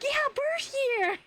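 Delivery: high, drawn-out mortified reply; awkward squeal. Retold or imitated. Streamer retelling her reply to the girl, awkward squeal. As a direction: stretch it out high, about to crack up.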